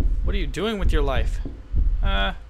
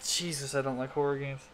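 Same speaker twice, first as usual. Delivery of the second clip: much softer, deflated; real, much softer; drained mutter; 2 semitones lower, 11 dB quieter than usual. Real, not acted. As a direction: mumble it, worn out from the fright. Drained after the scare.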